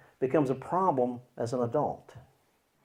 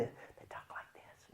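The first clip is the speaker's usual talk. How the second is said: barely audible slow whisper; soft-spoken; 24 dB quieter, slower than usual. Shown as a demonstration. Showing how a soft-spoken part talks, whispered. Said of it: "a part that's soft-spoken".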